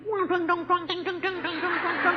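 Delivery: tiruan suara guru yang dibaca tanpa makna; meniru suara guru. Retold or imitated. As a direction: menirukan bunyi guru membaca. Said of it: "Bacai persis suarane guru". (murid menirukan suara guru membaca, sedikit dibuat-buat)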